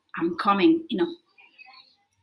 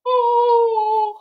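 mock yawn; 13 semitones higher, 5 dB louder than usual. Shown as a demonstration. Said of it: "I'm sleepy".

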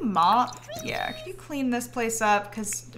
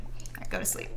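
whisper; 10 dB quieter than usual. Real, not acted. Putting baby to sleep.

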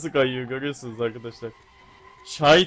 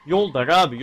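loud complaint; 7 dB louder than usual. Real, not acted. Complaining the road is narrow.

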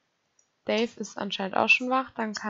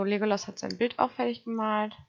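ordinary talk, nothing marked

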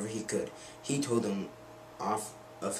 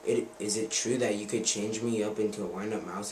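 ordinary delivery, nothing marked

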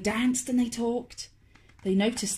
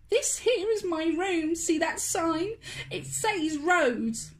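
put-on higher, slow indignant girl; a little girl huffing; a hint of a cross girl; huffy girl; 7 semitones higher than usual. A narrator's reading. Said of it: "Rose huffed".

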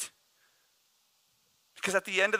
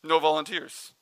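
usual speaking voice

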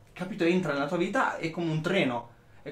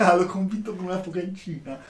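mocking girlfriend; 3 semitones higher than usual. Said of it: "così puoi dire alle tue amiche".

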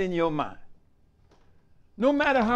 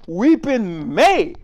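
"may" drawn out slowly and loud; stress on may; 5 dB louder, much slower than usual. Real, not acted. Preacher quoting scripture to the congregation, long stressed "may". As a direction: stretch "may" long and lean on it. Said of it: "Underline the word "may".

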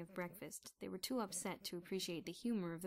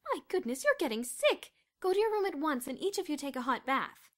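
high, louder worried scolding; fussing mother; 8 semitones higher, 11 dB louder than usual. A narrator's reading. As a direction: shoo them off to bed. Housemother fussing over sick boy.

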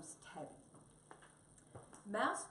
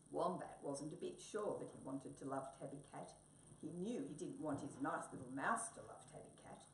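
ordinary delivery, nothing marked

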